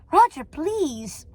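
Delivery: drawn-out, pleading reproof; exasperated wife. Acted. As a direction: hush the husband with strained patience. Wife hushes husband.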